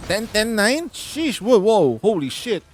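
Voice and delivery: high, loud burst of amazement; astonished. Real, not acted. Gamer reacting to a shocking game roll, astonished. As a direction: exclaim it loud and high, blown away.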